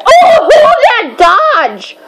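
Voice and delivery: high, loud, drawn-out shout; excited yell. Real, not acted. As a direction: stretch it out in a thrilled high yell. Yelling during the fight.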